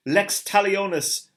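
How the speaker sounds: high, loud, strange-voiced proclamation; a seer crying out a prophecy, not murmured; seer's incantation. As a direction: intone it like a prophet to heaven. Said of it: "the sage repeated".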